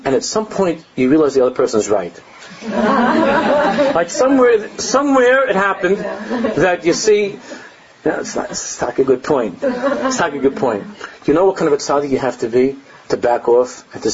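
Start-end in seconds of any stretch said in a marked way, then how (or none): 2.6-10.8 laughing. Real, not acted. Laughing at his own point.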